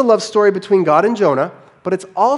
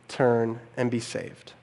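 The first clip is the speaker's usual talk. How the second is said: ordinary delivery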